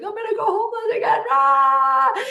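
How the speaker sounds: high, loud, fast wail rising into a scream; hammed-up meltdown, wailed and screamed; mock crying panic. Retelling nights stuck in Utah, acted panicked crying wail. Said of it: "crying every night".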